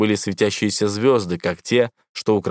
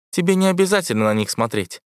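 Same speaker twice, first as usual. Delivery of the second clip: бархатный голос ИИ; 8 semitones higher than usual. A narrator's reading. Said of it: "сказала Эвредика".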